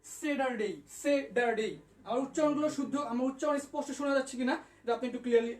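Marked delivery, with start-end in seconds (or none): 0.0-1.7 স্যাটারডে said "say-dar-day"; teacher's model of স্যাটারডে with a d sound; d in place of t. Shown as a demonstration. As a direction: soften the t of স্যাটারডে to a d. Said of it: "ট এর পরিবর্তে ড ব্যবহার করা যায়".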